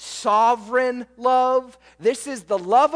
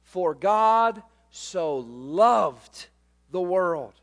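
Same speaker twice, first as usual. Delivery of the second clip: drawn out very slowly, reverent; slow scripture quote; 4 semitones lower, much slower than usual. Shown as a demonstration. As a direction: lean into each word of the verse. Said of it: "You know what it says?".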